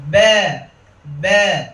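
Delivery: "ব্যা" tilted towards an e, like "be"; wrongly fronted "ব্যা"; imala mistake. Shown as a demonstration. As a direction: bend the vowel of "ব্যা" to eh. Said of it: "ইমালা করে বলি এইভাবে".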